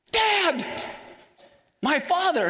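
high-pitched cry of "Dad"; exclaimed call. Acted. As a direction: call out urgently to a father. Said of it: "he's making an exclamation point here".

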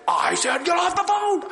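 high, loud mock yell; retold gunman yelling; robber's yell. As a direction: shout the order again, losing patience. Robber repeats order.

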